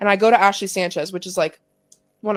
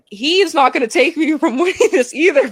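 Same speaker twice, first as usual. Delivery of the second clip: raised, louder retort cracking into a laugh; laughing defiance; 8 semitones higher, 3 dB louder than usual. Retold or imitated. Player answering her coach at halftime, laughingly defiant. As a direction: declare it high, then laugh through it.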